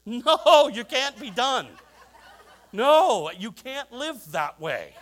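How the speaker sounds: loud, laughing, panicked protest; frantic pleading. Retold or imitated. Husband begging wife mid-birth to keep going, loud laughing panic. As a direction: beg her not to stop, half laughing.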